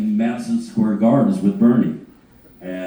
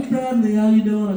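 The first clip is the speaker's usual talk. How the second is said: much higher, gushing mock greeting; high, sweet impersonation of a manager; Sharon; 11 semitones higher than usual. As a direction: imitate a delighted hostess. Voicing Sharon greeting him.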